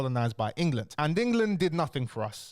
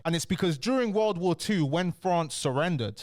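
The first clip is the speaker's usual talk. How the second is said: ordinary talk, nothing marked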